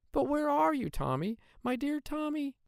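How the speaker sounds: high, worried call; a mother calling for her lost son; anxious mother crying out. A narrator's reading. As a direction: search frantically for her son. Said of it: "cried out his mother".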